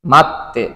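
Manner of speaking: মাত্তে split as mat-te with a held 't'; doubled consonant. Shown as a demonstration. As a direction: hold the 't' in মাত্তে before releasing 'te'. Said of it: "দুইবার উচ্চারিত হবে".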